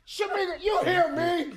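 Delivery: high-pitched impatient holler; mock neighbor calling, pitched high; yelling out. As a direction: call out to someone ignoring you. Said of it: "He yelling out".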